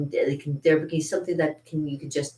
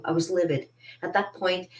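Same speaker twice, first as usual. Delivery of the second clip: ordinary delivery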